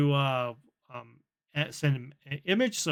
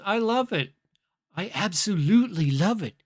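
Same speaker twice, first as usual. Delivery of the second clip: playful imitation of gushing delight; mock-delighted, lilting emphasis; delighted chatbot; 4 semitones higher than usual. Voicing chatbot delighted with haircut.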